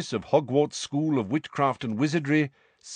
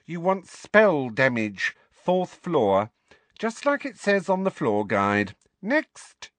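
higher, offhand clerk voice; bored receptionist; 6 semitones higher, slower than usual. Acted. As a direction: brush him off wearily.

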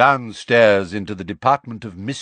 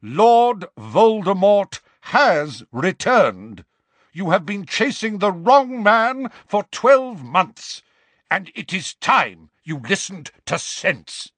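put-on slow, louder, commanding old wizard; thunderous; 6 semitones higher, 3 dB louder than usual. Dumbledore confronts the minister, thunderous and slow. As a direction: lay down the truth with force. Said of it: "in a thunderous voice".

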